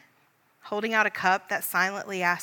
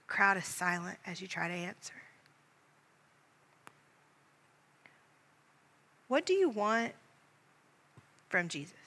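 guided meditation; 4 dB louder than usual. Acted. Leader guiding listeners in imaginative prayer, hushed meditation. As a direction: paint the scene gently for someone at prayer.

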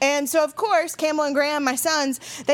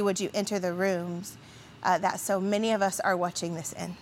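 soft, low prayer voice; quiet pastoral prayer; 4 semitones lower, 4 dB quieter than usual. Acted. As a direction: pray gently to God for the congregation. Pastor leads opening prayer.

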